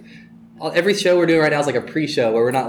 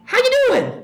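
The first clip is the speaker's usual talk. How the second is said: put-on very high, sing-song old showman voice; 3 dB louder than usual. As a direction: greet him way up high, flamboyant. Imitating [name] greeting.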